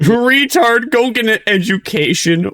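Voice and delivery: very high-pitched, hammed-up heckle; mock jeering. Streamer parodying an online insult, high mock jeer. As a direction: squeal it like a silly heckler.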